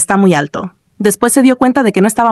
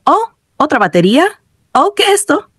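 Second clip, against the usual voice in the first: high, questioning surprise; surprised; 7 semitones higher than usual. A narrator's reading. Gamer spotting something on screen, surprised. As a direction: exclaim in rising surprise. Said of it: "Al ver algo en pantalla dijo".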